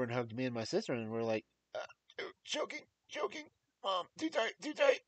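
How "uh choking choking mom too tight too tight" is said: squeezed breathless. Retold or imitated. Kids squeezed in mom's hug.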